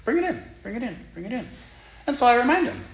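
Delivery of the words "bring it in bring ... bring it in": high, eager grandmotherly welcome; grandma gladly inviting sadness in, much higher; welcoming grandma. Pretend. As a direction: open your arms and pull it in, grandma. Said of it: "how Grandma just goes".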